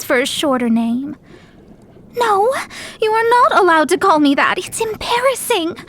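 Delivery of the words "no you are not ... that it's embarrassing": high, indignant, embarrassed protest; flustered objection. Acted. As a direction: forbid it, flustered and higher. Embarrassed at being given nickname.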